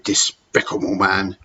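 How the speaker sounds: usual speaking voice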